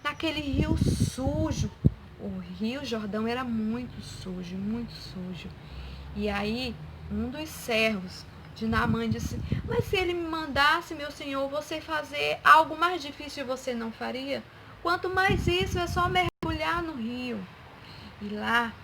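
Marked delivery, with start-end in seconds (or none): 0.0-1.7 indignant, higher and louder; very angry. Acted. Naamã objects to the dirty river, acted indignation. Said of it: "Naamã ficou muito bravo e disse". 9.7-17.4 higher-pitched pleading servant; urging. Acted. Servant persuades Naamã to dive in the river, urging. Servant reasons with angry master.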